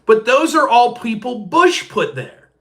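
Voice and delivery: high, slow, dopey insistence; slow high airhead voice; mock clueless girl. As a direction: play her as confidently wrong. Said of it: "And she says to me".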